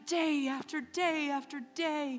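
high, loud sermon climax; impassioned preaching. Real, not acted. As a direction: drive the testimony home with full conviction. Preaching climax at full force.

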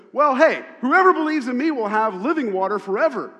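loud, high call over the music; proclaiming loudly. Retold or imitated. Jesus standing up at the feast, loud proclamation. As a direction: announce it to the whole courtyard. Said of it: "Jesus stands up and goes".

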